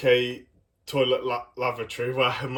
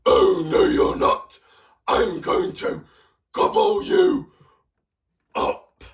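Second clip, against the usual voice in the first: loud rasping troll threat with high squeals; hungry troll threatening the goat; hammed-up monster troll; 6 dB louder, slower than usual. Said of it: "And the troll says".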